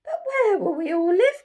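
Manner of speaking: high, loud pleading chorus; crying animals. Acted. Animals beg Jim where they will live, high loud plea. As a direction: plead all together in small high voices. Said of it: "cried the animals".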